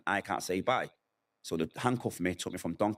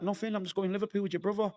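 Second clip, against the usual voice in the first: mum's voice; 12 semitones higher, faster than usual. Retold or imitated. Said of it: "She's like".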